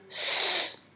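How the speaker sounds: S as a long hissed "sss"; isolated sound. Shown as a demonstration. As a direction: hold S like the start of cerise. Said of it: "et le S de cerise".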